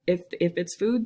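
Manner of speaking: ordinary talk, nothing marked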